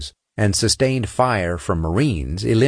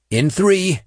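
high, raised-voice warning; countdown call; 11 semitones higher than usual. Acted. Saint readies the team to lift the door, calling out. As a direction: alert the team to get ready.

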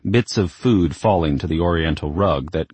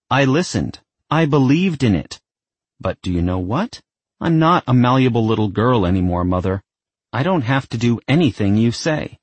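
defiant daughter; 7 semitones higher, 3 dB louder than usual. Acted. Isabel argues with mother.

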